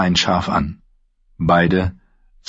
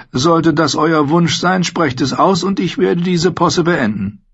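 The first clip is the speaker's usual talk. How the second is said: higher, earnest courtly apology; apologetic Tyrion; 9 semitones higher than usual. A narrator's reading. Tyrion to Sansa, sincerely apologising. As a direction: make amends sincerely.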